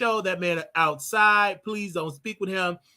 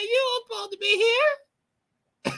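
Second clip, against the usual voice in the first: very high, drawn-out squeal; slow, hammed-up high voice about to crack up; mock boss squeal; imitated boss; much slower than usual. Boss retold, breaking into laughter.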